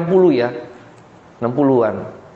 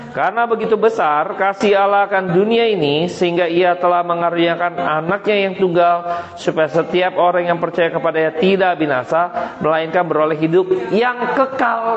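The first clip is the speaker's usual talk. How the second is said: measured, raised-pitch scripture recitation; leading unison reading; 3 semitones higher than usual. Real, not acted. Preacher leads congregation in reading the verse together, measured recitation. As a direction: read it out steadily for everyone to follow. Satu, dua, tiga.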